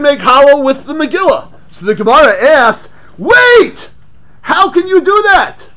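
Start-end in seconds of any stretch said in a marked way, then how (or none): 3.2-5.7 high, sharp cry of disbelief; astonished objection. Acted. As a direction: jump in as if caught off guard. Voicing the Gemara's challenge.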